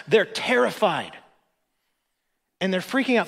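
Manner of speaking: preaching at full force. Acted. Pastor rouses the congregation about the storm, impassioned preaching. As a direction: preach it big and urgent to the whole room.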